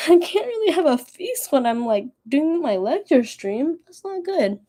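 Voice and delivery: high, laughing mock despair; half-laughing, squeaky complaint. Real, not acted. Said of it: "I'm crying now".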